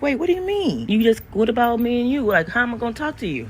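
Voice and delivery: high, pleading "wait, what?"; alarmed disbelief. Retold or imitated. Telling her friend, alarmed and pleading, pitch way up.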